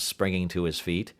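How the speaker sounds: usual speaking voice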